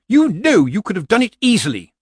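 high, loud outburst of rage; acted scream. Lettice loses control at Granny, screaming in rage. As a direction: scream it at her, pitched right up. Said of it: "Lettice's scream echoed off the mountains".

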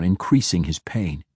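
ordinary delivery

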